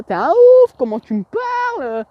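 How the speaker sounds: very high, loud, hammed-up shocked woman; exaggerated outcry. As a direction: overplay her outrage for the camera. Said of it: "là, j'exagère parce que je suis youtubeur". (imitation exagérée, très aiguë et forte)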